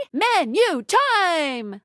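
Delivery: slow, stretched-out game-host call; showy announcement. Acted. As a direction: draw out each word like announcing the main event.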